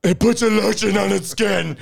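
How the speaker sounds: high, eerie, creepy whisper-voice; Buffalo Bill. Pretend. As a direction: go high and creepy, like the killer in the pit. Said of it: "can you do Buffalo Bill?".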